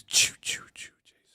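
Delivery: breathy Jason horror whisper; whispered horror movie sound. Retold or imitated. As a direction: hiss it like the horror movie cue. Jason Voorhees sound effect.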